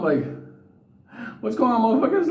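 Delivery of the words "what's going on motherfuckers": high, cocky hello to the crowd; rowdy greeting back. Retold or imitated. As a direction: throw it back at them with swagger.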